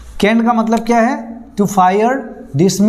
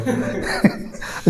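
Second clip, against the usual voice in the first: soft laugh; 9 dB quieter than usual. Real, not acted. Joke about firing juice.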